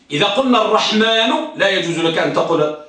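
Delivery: ordinary talk, nothing marked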